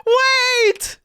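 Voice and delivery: high, loud, slow excited cry; excited shout. Real, not acted. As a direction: yell it out, thrilled. Shouting excitement about Jenova.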